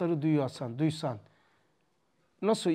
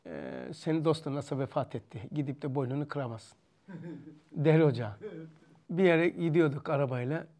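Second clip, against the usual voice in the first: normal talking voice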